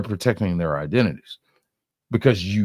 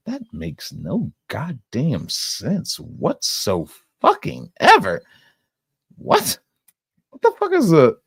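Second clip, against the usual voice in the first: angry outburst; 8 semitones higher than usual. Real, not acted. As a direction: blow up in disbelief. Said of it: "I can tell you're angry right now".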